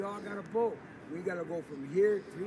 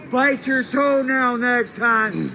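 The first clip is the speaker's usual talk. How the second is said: louder, higher, slow stretched taunt; drawn-out yell; 7 semitones higher, 18 dB louder, much slower than usual. Real, not acted. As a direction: bellow it, dragging each word.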